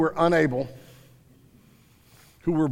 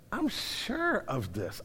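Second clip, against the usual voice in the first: soft, slow imitation of a teenage girl; 5 semitones higher, 8 dB quieter, slower than usual. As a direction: lightly put on a girl's quiet, drawn-out voice. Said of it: "a teenage girl walks up to him".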